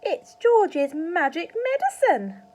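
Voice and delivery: put-on high, loud and slow old woman's shout; slow, shrill granny bellow; Grandma shouting. A narrator's reading. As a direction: crow it proudly from the roof. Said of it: "Grandma shouted".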